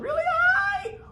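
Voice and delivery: squeaky high voice, held out; really high pitch. Shown as a demonstration. Teacher demonstrating pitch to the class, really high. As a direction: soar way up in pitch. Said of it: "Pitch is how I can make something sound".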